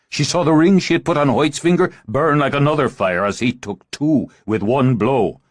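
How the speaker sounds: ordinary delivery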